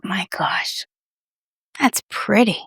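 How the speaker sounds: slow hushed gasp of admiration; awed whisper. Real, not acted. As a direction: let it slip out in a whisper. Admiring lipstick up close.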